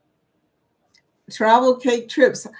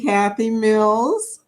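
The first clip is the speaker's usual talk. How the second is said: normal talking voice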